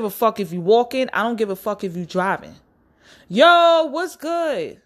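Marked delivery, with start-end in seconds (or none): 3.3-4.8 yelled greeting. Pretend. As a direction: shout the greeting at him in the street. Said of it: "because I'm yelling in the street".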